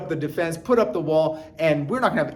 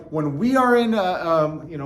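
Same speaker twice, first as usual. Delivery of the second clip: ordinary delivery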